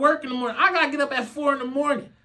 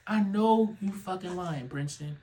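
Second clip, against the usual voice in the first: ordinary talk, nothing marked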